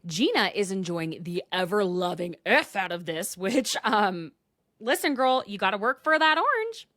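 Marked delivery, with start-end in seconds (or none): 4.8-6.9 sassy callout. Real, not acted. Mocking a cast member.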